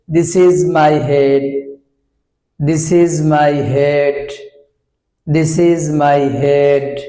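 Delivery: put-on very slow, each word spaced for children; teacher drill for kids, word by word; slow teaching drill. Shown as a demonstration. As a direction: model the sentence slowly for young learners. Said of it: "আমরা this ব্যবহার করে আমরা শেখাতে চাই".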